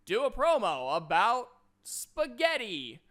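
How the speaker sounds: loud, high, drawn-out promo challenge; mock promo shout. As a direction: announce a silly challenge with overblown force. Mocks a silly promo challenge.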